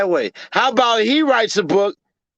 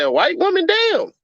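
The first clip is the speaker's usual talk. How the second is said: shooting way up on "Damn"; mock shock; 10 semitones higher than usual. Women in chat reacting to the news, voice leaping up in shock. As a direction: gasp out the disbelief. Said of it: "y'all gonna say".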